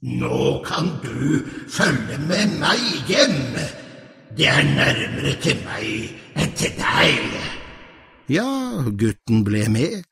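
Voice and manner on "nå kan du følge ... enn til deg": slow, commanding troll; a troll ordering the boy home. Acted. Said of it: "sa trollet".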